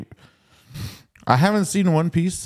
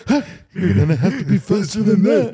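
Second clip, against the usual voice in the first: put-on old man voice; 5 semitones higher than usual. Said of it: "the girl goes for it".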